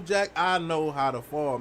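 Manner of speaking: slow, drawn-out Jack, I know how to fall; slow boast. Real, not acted. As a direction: lean back and savor each word. Repeating the boast slower.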